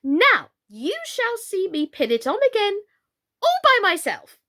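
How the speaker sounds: high, rising pride; boast, pitched well up; proud queen. Acted.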